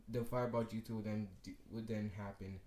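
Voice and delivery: ordinary delivery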